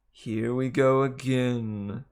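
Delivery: very slow, grumbling snake; slow sulky male snake voice; George the snake. Acted. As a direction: sulk it out slowly. George complaining.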